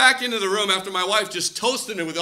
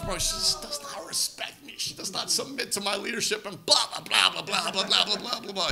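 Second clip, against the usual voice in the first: bitter hushed complaint; 5 dB quieter than usual. Retold or imitated. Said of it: "like Satan has really gone into my wife".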